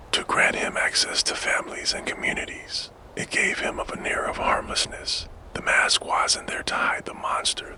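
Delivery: ordinary delivery, nothing marked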